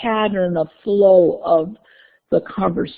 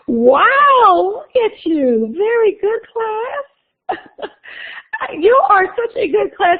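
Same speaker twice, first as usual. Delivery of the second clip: mock gushing praise; 13 semitones higher, slower than usual. Teacher gushing praise at quiet class.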